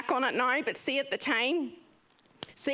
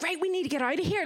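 urgent; 7 semitones higher, faster than usual. Retold or imitated. Urging people off crashed bus.

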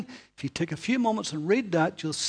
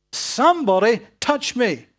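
loud insisting; 4 semitones higher, 7 dB louder than usual. Acted. Jesus insisting loudly.

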